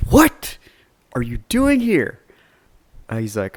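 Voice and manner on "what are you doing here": much higher and louder, a stunned yell; shocked outburst. Retold or imitated. Game store clerk hearing the customer is the gymnast's brother, stunned yell. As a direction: blurt it out in disbelief. Retold shock at the sister news.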